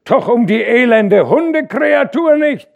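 loud scornful yell; defiant shout. A narrator's reading. A worker below shouting back in contempt.

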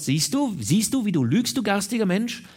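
high, heated reproach; indignant high-pitched tirade. A narrator's reading. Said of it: "Da fuhr sie entrüstet fort".

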